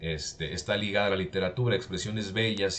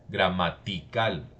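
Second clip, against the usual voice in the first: "gramatical" drawn out slowly, stressed on CAL; slow demonstration of gramatiCAL; stress on last A; slower than usual. Shown as a demonstration. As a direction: slowly lean on the final A of "gramatical". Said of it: "en la última A".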